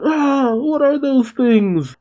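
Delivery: high, drawn-out cry of horror; freaked-out students. Acted. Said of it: "The students freak out".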